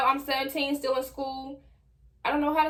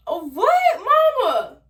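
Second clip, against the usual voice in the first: whiny complaint; 10 semitones higher than usual. Retold or imitated. Embarrassed teen whining at her mama for telling everybody, very high. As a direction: whine at mama, pitch way up.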